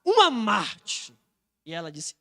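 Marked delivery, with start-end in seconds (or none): 0.0-1.1 drawn-out mocking "ah"; acted irony, stretching the words out; ironic. Centurion mocking Maria Madalena's answer, with irony. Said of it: "com um tom de iro-, de ironia".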